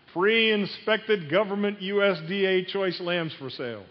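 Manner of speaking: high, slow, drawn-out announcement; proclaimed sales sign. Acted. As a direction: read the sign out like a market pitch. Acted reading of booth sign.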